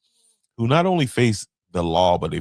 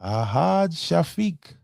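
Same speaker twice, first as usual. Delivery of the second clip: slow careful name; 11 semitones higher, much slower than usual. Shown as a demonstration. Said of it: "careful name reading".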